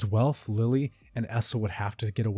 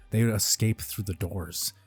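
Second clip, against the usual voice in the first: usual speaking voice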